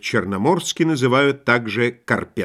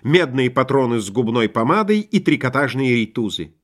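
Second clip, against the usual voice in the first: plain reading voice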